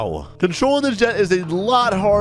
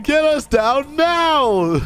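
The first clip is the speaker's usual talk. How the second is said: high, drawn-out acted demand; alarmed instructor; 10 semitones higher, much slower than usual. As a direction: act a panicked passenger ordering a landing.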